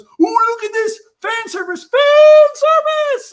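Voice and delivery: very high, loud, stretched squeal; hammed-up squeal, slow on the last words; mock fan service squeal. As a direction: shriek it like a thrilled fan. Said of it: "a thing that goes".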